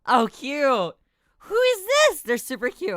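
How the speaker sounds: high, loud delighted squeal; excited squeal. Real, not acted. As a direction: squeal over something cute. Squealing at cute character.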